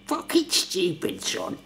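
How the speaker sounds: high, strained impression of dad; slow, drawn-out mock telling-off from dad; imitating dad. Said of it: "He's like".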